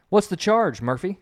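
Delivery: a mild mock growl from a crusty old desk sergeant. A narrator's reading. Desk sergeant questions the rookie cop, mild mock growl. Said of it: "growled the crusty old desk sergeant".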